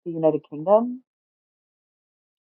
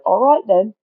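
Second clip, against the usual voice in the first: put-on higher, louder English-accented quote; 6 semitones higher, 6 dB louder, slower than usual. Imitating the English guy agreeing to an interview, English accent. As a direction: lightly mimic the Englishman's accent. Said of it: "he was like".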